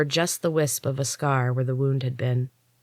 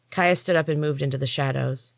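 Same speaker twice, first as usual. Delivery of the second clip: ordinary delivery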